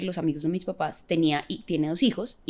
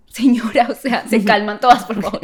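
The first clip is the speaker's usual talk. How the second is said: louder exasperated please calm down; 4 semitones higher, 9 dB louder than usual. Retold or imitated. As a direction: raise your voice to settle them. Pleading with shouting officers.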